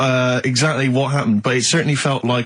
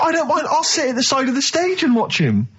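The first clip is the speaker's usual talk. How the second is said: high pleading whine; 12 semitones higher than usual. Acted. Pleading to sit by stage.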